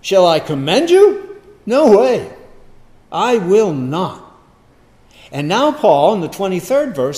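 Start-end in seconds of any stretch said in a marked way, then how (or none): 0.0-4.3 louder, higher, slow No way; harsh refusal. Acted. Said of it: "See how harshly he deals".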